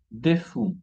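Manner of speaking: "Des fous" as day FOO; silent final S. Shown as a demonstration. Teacher repeats the correct plural, "Des fous" with silent S. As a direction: leave the S off "fous". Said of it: "No se pronuncian la S al final".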